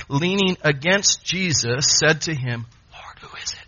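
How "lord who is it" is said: hushed, voiceless whisper; secretive whispered aside; whispered question. Acted. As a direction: whisper it privately to the one beside you. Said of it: "whispers to John".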